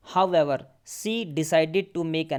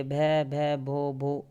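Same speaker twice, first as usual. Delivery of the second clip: stuttering भै भै, भो भो, stuck on the first sound; stammering; much slower than usual. Shown as a demonstration. Shy girl stammering her name to the kind teacher, stuttering. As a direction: stumble over the name, stammering in fear. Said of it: "She began to stammer".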